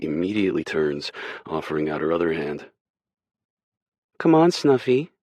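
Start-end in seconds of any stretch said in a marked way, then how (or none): 4.2-5.1 high, bright, playful coaxing; smiling invitation. Acted. Rachel invites Kay to dance, smiling and playful. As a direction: pull him up with a smile. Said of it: "she says with a smile".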